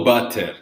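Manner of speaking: BUTTER with a clearly released hard T; crisp T, wrong way. Shown as a demonstration. Teacher repeating the mistake to avoid, hard T in "butter". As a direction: sound out the T in "butter". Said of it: "No T. No butter".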